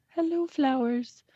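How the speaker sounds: high voice talking to flowers; host talking to the plants with the new word; playful pretend. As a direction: coax the flowers like a friendly gardener. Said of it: "addresses the flowers playfully".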